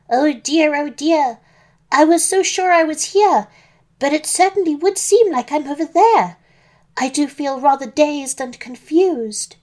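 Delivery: put-on high, fretful old Granny voice; muttering Granny, dazed. A narrator's reading. Granny seeing her double in bed, dazed muttering.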